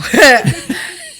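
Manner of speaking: loud, high laugh. Real, not acted. Laughing after silly accents.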